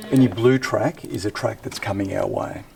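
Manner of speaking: ordinary delivery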